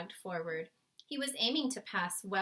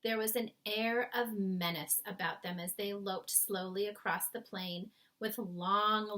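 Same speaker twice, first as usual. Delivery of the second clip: usual speaking voice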